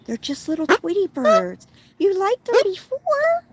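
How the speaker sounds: high coaxing pet voice. Real, not acted. Soothing bird in baby talk.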